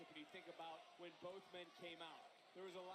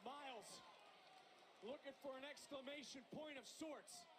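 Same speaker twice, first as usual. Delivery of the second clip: higher, louder finish call; excited call; 6 semitones higher, 21 dB louder, slower than usual. Real, not acted. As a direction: build up to the pin. Calling the finish.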